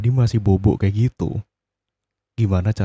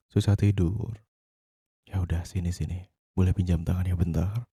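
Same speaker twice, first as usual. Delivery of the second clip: hushed bedtime voice; 6 dB quieter than usual. Acted. Soothing partner to sleep at night.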